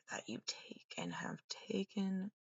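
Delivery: whispered, almost breathless. Real, not acted. Whispering to the camera about what was taken. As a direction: let it fall to a bare whisper.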